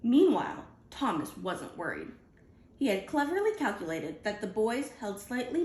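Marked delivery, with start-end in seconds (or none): none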